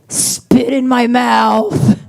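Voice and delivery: slow, drawn-out mock demand; stretched-out imitation of a partner in bed; imitating boyfriend. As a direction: play the lover's slow command.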